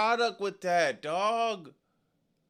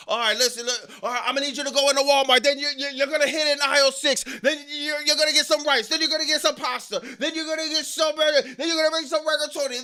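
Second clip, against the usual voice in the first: higher and fast, parodying a stiff promo; mock scripted instructions; 7 semitones higher, much faster than usual. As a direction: rattle off a grocery list like a scripted wrestler. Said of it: "Imagine if somebody talked to you like that".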